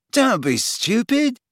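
high, slow sharp retort. A narrator's reading. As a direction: snap it back. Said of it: "said Ron sharply".

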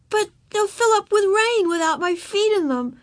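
put-on very high, whiny little-girl voice; protesting child. A narrator's reading. Girl arguing for her boots, protesting. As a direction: object plaintively like a small child. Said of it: "protested Ramona".

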